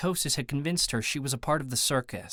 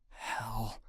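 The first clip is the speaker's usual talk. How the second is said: low, very soft mutter; muttered under breath; 3 semitones lower, 10 dB quieter than usual. A narrator's reading. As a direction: curse quietly to yourself. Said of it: "I muttered under my breath".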